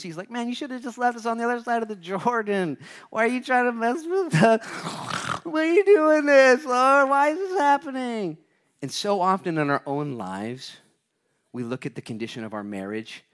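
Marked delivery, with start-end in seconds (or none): 0.3-8.4 high-pitched whine, growing louder; mock whining. Preacher acts out Joshua complaining to God, mock whining. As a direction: wail it like a hard-done-by complainer. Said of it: "kind of whine a little about it and sing the blues".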